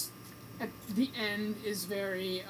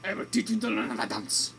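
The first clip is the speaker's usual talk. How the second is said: lightly voiced deep Spanish accent, much lower; a hint of a suave Spanish dance teacher; Antonio Banderas impression; 10 semitones lower than usual. Mocking the movie's dance teacher, in a light Spanish accent. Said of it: "Antonio Banderas is kinda like".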